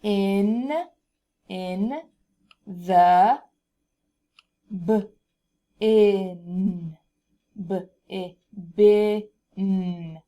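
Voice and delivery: each sound said apart; sounding out words. Shown as a demonstration. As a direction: sound it out slowly. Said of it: "So we have one cat naps".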